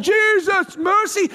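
high-pitched cry for mercy; retold shouting. Blind beggar still calling to Jesus, retold shout. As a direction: call out high, pleading. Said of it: "now he's shouting".

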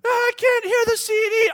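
put-on high, very loud boy's holler; imitated child screaming. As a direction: shriek like a small boy over blaring music. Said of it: "all he's doing is screaming this".

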